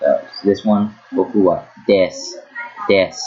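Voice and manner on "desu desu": desu said as "des", no u; silent final U. Shown as a demonstration. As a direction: drop the u from desu. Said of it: "it applies to most words".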